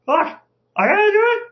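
high-pitched, incredulous disbelief; dawning realization. Acted. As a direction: voice jumps up as it sinks in. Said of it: "you realize, like".

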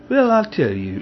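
mock aristocratic British accent, lightly done; a hint of a posh British accent, playing the gentleman; bad British accent. Imitating the vet's posh British accent for the viewers. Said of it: "this kind of weird aristocratic British accent".

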